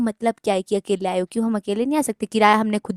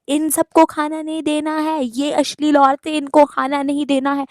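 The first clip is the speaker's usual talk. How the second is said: mock drunken yelling; 6 semitones higher than usual. Retelling a drunk man yelling from the hotel gate, mock yell. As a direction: ham up the man bellowing from the gate. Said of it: "वो gate से चिल्ला रहा है".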